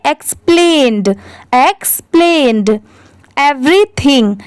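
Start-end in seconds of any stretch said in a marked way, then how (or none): 0.1-2.8 "एक्सप्लेन्ड" carefully, with a released final d; sounded final D. Shown as a demonstration. Teacher modelling the ED form, audible d in "एक्सप्लेन्ड". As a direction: land on the duh ending of "एक्सप्लेन्ड". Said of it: "इसको हम लोग क्या पढ़ेंगे?".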